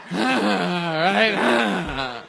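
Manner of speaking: mock "right?" running into a laugh; imitated self-amused laughing. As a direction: mimic a comic cracking up at their own joke. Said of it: "on every punchline you're like".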